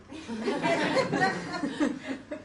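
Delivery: loud laugh falling away; long high laugh. Real, not acted. Speaker laughing after the Harry line, real laugh. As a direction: burst out laughing at the story.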